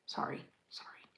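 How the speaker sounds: quick breathy whisper; whispered apology. Real, not acted. Builder whispers sorry to the viewers after squealing. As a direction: hush yourself after a squeal.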